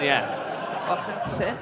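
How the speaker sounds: ordinary talk, nothing marked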